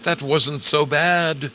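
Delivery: loud, high and slow; loud mock-dramatic. Real, not acted. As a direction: belt it out like a showman.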